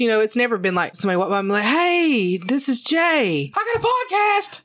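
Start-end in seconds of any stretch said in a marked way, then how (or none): 1.6-4.6 much higher, slow, cheesy announcer; mock self-promo. Pitching the podcast to passersby in a hammed-up voice. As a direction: ham up a corny plug for yourself.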